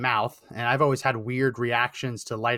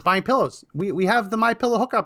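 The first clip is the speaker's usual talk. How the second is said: incredulous; 6 semitones higher than usual. Retold or imitated. Retelling his reaction to wife.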